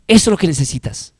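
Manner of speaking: ordinary delivery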